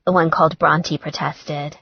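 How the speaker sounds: plain reading voice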